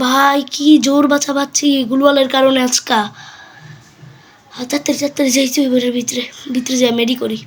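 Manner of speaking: usual speaking voice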